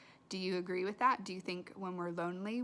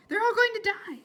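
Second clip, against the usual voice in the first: urgent outcry; 13 semitones higher, 12 dB louder, much faster than usual. Acted. Flora insists to Ulysses.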